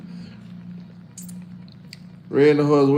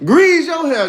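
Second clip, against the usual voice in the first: loud high tease; 12 semitones higher, 6 dB louder, slower than usual. Real, not acted. Teasing a viewer in chat.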